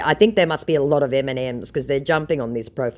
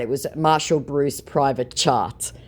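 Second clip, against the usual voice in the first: usual speaking voice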